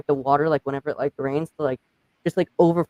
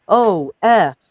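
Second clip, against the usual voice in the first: mock exclamation; 4 semitones higher, 6 dB louder than usual. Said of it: "I'd be like".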